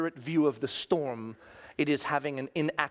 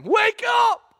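frantic shout; 9 dB louder than usual. Acted. Disciples shouting to wake sleeping Jesus, panicked yell.

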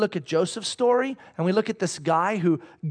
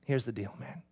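much softer, low, intimate voice; God's gentle whisper; 7 semitones lower, 5 dB quieter than usual. Pretend. God reassuring the listener, soft and hushed. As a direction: speak it softly like God leaning close. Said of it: "let him whisper to you and say".

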